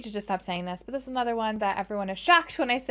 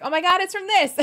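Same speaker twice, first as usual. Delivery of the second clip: excited; 9 semitones higher, 6 dB louder than usual. Retold or imitated. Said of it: "I'm gonna hear and be like".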